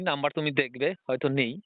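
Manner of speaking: ordinary delivery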